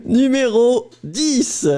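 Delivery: slow, high French teacher; Numéro dix said slowly and high like a French teacher. Acted. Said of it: "you literally sound like my French teacher".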